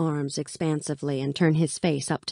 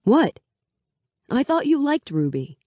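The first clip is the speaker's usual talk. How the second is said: much higher, puzzled What; mock innocent; 10 semitones higher, 4 dB louder than usual. A narrator's reading. Mal feigning innocence at Alina's eye roll. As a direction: play innocent, all surprise.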